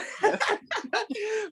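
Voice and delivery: squealing giggle; high squeal of laughter. Real, not acted.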